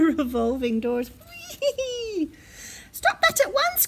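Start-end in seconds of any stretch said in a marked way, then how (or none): 1.6-2.3 put-on high, long child's squeal of fun; gleeful whee. A narrator's reading. Bear spinning in revolving doors. 2.9-3.9 high, urgent parental telling-off; crying out. A narrator's reading. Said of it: "cried Father Bear".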